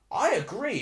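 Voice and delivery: high and loud, still worked up; heated agreement. Real, not acted. Streamer backs a viewer about the foul, high and heated. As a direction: agree hotly.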